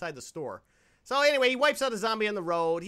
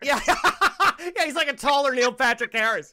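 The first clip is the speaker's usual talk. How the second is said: laughing; 4 semitones higher, 8 dB louder than usual. Real, not acted. Laughing agreement.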